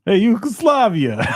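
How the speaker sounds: mock Italian accent, like doing my cousin Vinny; bad Italian accent. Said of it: "Why do you sound like Mario?".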